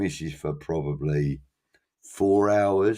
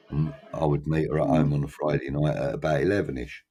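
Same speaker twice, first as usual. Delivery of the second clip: normal talking voice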